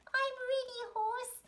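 very high snail voice; plucky little snail. Acted. Snail replies at the start line, high little voice. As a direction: answer in a tiny squeak.

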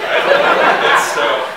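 high burst of laughter; laughing hard through the words. Real, not acted. Bursts out laughing mid-story.

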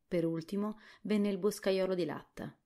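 ordinary delivery, nothing marked

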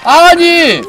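very loud high outraged yell; shocked yell. Real, not acted. Gamer shrieking at the dad slurping from the pot, loud high yell. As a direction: scream at him in disbelief.

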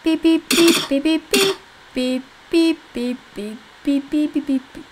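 high, loud mock beeps, over and over; beeping noise. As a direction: imitate an alarm beeping.

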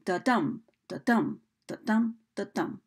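da-dum with a light da and a heavy DUM; iambic heartbeat rhythm. Shown as a demonstration. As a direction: beat out da-dum like a heart, second syllable stressed. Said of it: "iambic meter is like a heartbeat".

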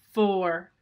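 loud countdown announcement in a lightly put-on computer voice; blaring computer voice. The contraption's speaker starts the countdown, lightly robotic and loud. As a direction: blare out the count like a machine. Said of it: "A scratchy computer voice blared".

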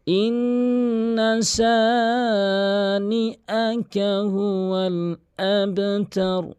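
solemn tilawat; Quranic recitation. Shown as a demonstration. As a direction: intone each word long and melodic. Reciting the verse.

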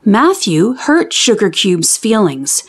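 usual speaking voice

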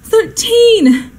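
high wail that falls away; dismayed exclamation. Acted. Hostess left with thirteen by a guest, dismayed. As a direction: cry out the number in dismay.

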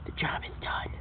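low hushed villain voice; hushed dog villain. Acted. Dog reporting the finished job, low hushed voice. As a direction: murmur it like a brute after the deed.

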